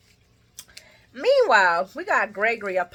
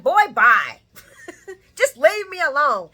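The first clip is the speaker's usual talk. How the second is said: louder, higher brush-off; sassy dismissal; 8 semitones higher, 10 dB louder than usual. Retold or imitated. Lucy loudly brushing off the man she slept with. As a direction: send him packing with sass. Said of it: "She's like".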